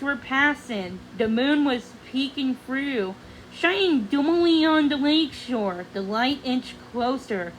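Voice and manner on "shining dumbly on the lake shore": usual speaking voice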